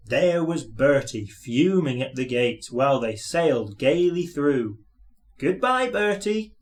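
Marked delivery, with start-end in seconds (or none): 5.4-6.5 high gleeful farewell call; a bright, high calling goodbye; called gleefully. A narrator's reading. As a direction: sing out a cheeky goodbye. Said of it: "called Thomas".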